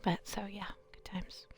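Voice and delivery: soft unvoiced whispered aside. Real, not acted. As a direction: breathe it out as a quiet aside. Quiet aside while playing.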